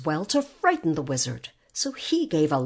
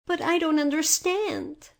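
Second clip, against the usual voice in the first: put-on high-pitched, puzzled young girl; bewildered; 9 semitones higher than usual. A narrator's reading. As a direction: protest in mild confusion. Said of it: "said Dorothy in bewilderment".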